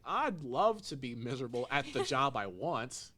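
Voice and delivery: a joking sigh at their dream job; wry envy. Retold or imitated. Podcaster voices the bar worker envying her friends. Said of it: "She's like".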